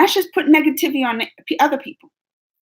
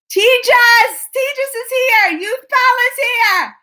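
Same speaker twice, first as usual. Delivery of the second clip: high, drawn-out excited shout; excited greeting; 11 semitones higher, 4 dB louder, slower than usual. Real, not acted. Host hails viewers as they arrive, excited greeting.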